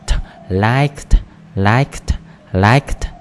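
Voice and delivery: liked said loud with a crisp final t, three times over; final sound drill. Shown as a demonstration. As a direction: really hit the end of liked, /lajkt/, not like it. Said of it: "âm đuôi là th". (liked đọc to, bật rõ âm cuối t)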